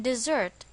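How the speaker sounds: "dessert" with the stress on its second syllable, di-ZERT. Shown as a demonstration. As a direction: lean on the end of "dessert", di-ZERT. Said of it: "the stress is on the second syllable".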